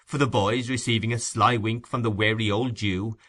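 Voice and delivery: plain reading voice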